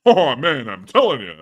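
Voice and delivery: loud, exasperated comedian catchphrase voice; put-on comic grumbler; Rodney. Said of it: "with that Rodney voice".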